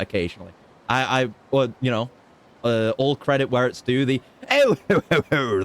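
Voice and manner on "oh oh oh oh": high, loud little oh-oh titter; borrowed fop laugh from a friend; mock genteel laughing oh oh; foppish laugh. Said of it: "that, that style of laugh".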